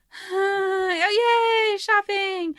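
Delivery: high, drawn-out gasp and cheer; light, high-pitched pretend glee; mock delight. Retelling the heroine's first day of the trip, mock delight.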